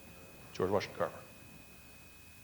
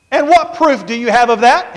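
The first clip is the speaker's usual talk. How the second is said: much higher, louder demand; Booker T demanding; 11 semitones higher, 12 dB louder than usual. Retold or imitated. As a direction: demand proof in a high voice.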